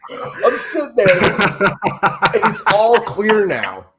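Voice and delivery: high voice breaking into laughter; real laughter through a high-pitched realization; laughing punchline. Real, not acted. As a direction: draw it out slowly while cracking up. Cracking up at his own punchline.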